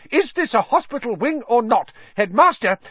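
high, loud, frantic outburst; hysterical. A narrator's reading. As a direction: cry out in high-pitched hysteria. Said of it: "said Madam Pumphrey hysterically".

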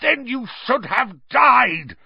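loud, high, slow furious roar; enraged drawn-out shout. A narrator's reading. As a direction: roar it with full force.

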